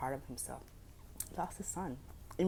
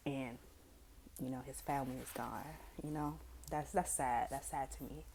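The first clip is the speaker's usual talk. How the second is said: usual speaking voice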